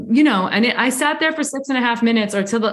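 usual speaking voice

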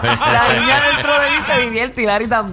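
laughing. Real, not acted. Admitting she enjoys the game.

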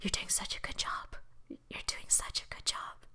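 barely audible whisper. Retold or imitated. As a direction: breathe it as a soft inner voice. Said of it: "I could hear the Lord".

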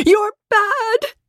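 put-on very high, crying little-girl voice; small child yelling through tears; tearful child's shout. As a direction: shout it while fighting back tears. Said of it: "she shouted, her face twisted".

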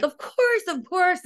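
very high, bright, eager of course; gushing agreement to a stranger; eager yes. Retold or imitated. Wife greeting a fan's husband, high and eager.

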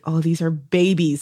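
ordinary talk

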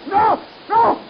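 terrified shrieks. Acted. Mad scientist shrieking at his own spiders attacking him. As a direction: scream as the spiders turn on him.

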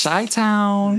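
high, drawn-out cheer; excited shout-out. Real, not acted. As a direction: greet the hometown crowd with a high whoop. Cheering the hometown by nickname.

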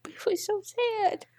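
high, breathless whisper through tears; barely gets the words out, still crying; tearful whisper. Real, not acted. Host agreeing through tears, whispering tearfully. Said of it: "I cried even so hard just then".

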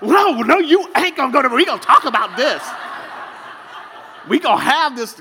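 loud, high confrontational outburst; mock angry wife. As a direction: imitate a wife demanding a showdown. Wife rips covers off, confronts him.

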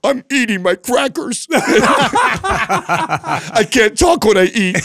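put-on whiny crying toddler. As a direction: imitate a sulky little boy whining through his snack. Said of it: "angry face and starts to cry".

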